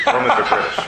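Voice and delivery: real amused laugh. Real, not acted. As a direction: crack up on the last words. Punchline of own joke.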